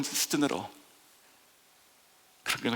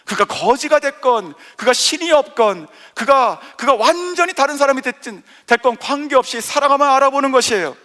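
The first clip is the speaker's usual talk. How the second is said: loud, soaring pulpit voice; impassioned sermon delivery; full-force preaching; 7 semitones higher, 9 dB louder than usual. Acted. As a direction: preach it to the back pews.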